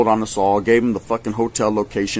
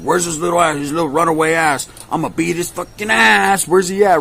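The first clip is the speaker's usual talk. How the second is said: hammed-up threat to whoop him; mock angry mom; 6 semitones higher than usual. His mom on the phone, a mock furious high rant.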